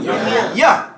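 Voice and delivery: laughing "Yeah" to the crowd; laughing echo. Real, not acted. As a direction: laugh along with the audience. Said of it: "Can you guys say".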